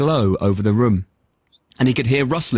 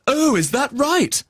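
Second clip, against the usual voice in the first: teasing unicorn; 12 semitones higher, slower than usual. Acted.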